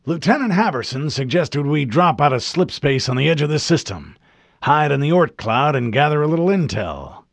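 put-on an old officer spinning it easy over the radio; drawling admiral. A narrator's reading. Voiced admiral over comm.

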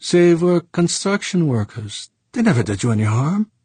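reproachful Frenchman. Acted. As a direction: reproach an old friend for killed friends. Saint Clair accusing Fuchs.

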